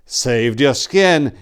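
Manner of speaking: slow, loud, savoring the words with a grin; grinning boast. Acted. Silenus grinning at Tor after the kill, slow boast. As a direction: gloat with battle thrill. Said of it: "Grinning with the thrill of battle".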